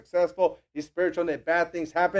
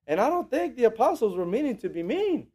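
normal talking voice

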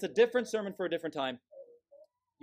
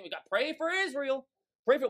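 higher-pitched mock sloganeer; mocking parroting; 6 semitones higher than usual. Preacher mimicking believers repeating ad nauseam, mocking.

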